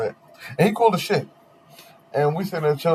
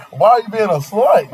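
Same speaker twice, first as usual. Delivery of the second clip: high, loud indignant jab; loud retort; 7 semitones higher, 6 dB louder than usual. Retold or imitated. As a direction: throw it at her, outraged. Repeats the comeback.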